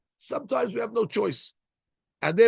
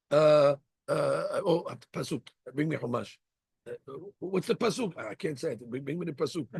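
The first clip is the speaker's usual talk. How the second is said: mock helpless mumble; 3 semitones lower, 7 dB quieter than usual. Rabbi fumbling for a verse.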